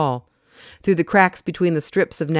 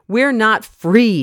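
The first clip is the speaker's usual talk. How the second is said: slow, held-back fury, higher and louder; suppressed anger; 7 semitones higher, 5 dB louder, much slower than usual. Acted. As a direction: hold the anger down as it leaks out. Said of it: "voice quivered with suppressed anger".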